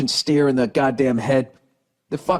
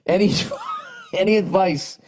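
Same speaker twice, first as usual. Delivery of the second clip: laughing; 8 semitones higher than usual. Real, not acted. Laughs while reading letter.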